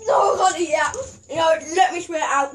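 panicked protest through a mouthful; eating gross jelly beans. Real, not acted. Gagging on bean.

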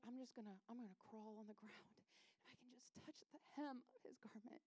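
soft, secretive murmur to herself; hushed resolve. Acted. Bleeding woman creeping toward Jesus's hem, hushed resolve. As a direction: keep it small and unseen. Sick woman planning to go unseen.

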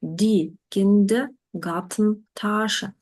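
each part spelled out slowly; slow, careful teacher demo; slow model word. Shown as a demonstration. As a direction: say it slowly for learners to copy.

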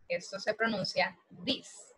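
ordinary delivery

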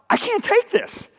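high, slow, strained plea; frightened head voice. Retold or imitated. His head fearing God's presence, high and pleading. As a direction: squeeze it up like panic.

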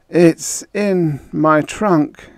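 slow, high, weakened voice; weak. A narrator's reading. Beaten prisoner confessing to Henderson, weakly. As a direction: drag the words out feebly. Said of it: "he said weakly".

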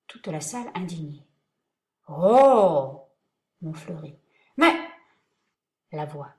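ordinary delivery

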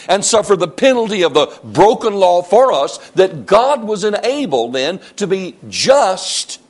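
high, driving, emphatic sermon delivery; full-force preaching. Real, not acted. Preacher proclaiming that God sent Christ, full-force preaching. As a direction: build the gospel point phrase by phrase.

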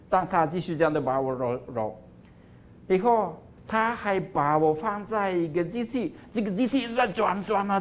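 plain reading voice

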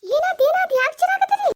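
high alarmed calling out; alarmed shout. Acted. Owner running out at the screams, shouting high in alarm.

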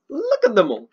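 high, delighted coo at the dogs; excited cooing. Real, not acted. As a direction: gush over the puppies. Cooing over new dogs.

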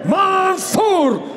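ordinary talk

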